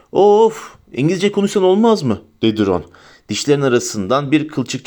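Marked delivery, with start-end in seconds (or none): 0.1-2.2 exasperated groan. Acted. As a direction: groan it out, sick of the lecture. Ron groans at Hermione's lecture.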